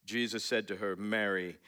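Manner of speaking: low, monotone British scripture reading; mock British reading. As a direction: read it in a droning British accent, no emotion. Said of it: "I don't know why I become British in that".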